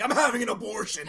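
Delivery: mock outcry. Telling her what to yell at him, mock outcry. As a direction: cry out in pretend alarm, laughing. Suggested outburst acted out mockingly.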